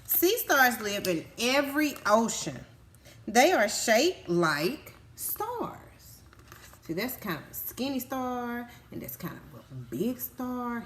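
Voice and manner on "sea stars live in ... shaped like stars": read-aloud. Acted. Reading book page aloud.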